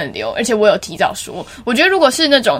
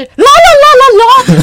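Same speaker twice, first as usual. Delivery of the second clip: much louder, higher and slower sung la la; blasting la la; 11 dB louder, much slower than usual. Shown as a demonstration. Mocking a classmate who sings far too loud. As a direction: belt it out and drown everyone. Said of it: "唱得非常的大声".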